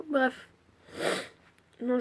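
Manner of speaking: normal talking voice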